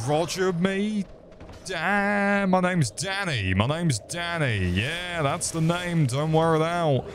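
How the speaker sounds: a cocky goofball introducing himself; silly character voice. Pretend. Plays a character named Danny.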